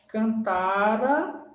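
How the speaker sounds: "cantara" drawn out slowly with no final U glide; wrong ending demo. Shown as a demonstration. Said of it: "não é cantaram".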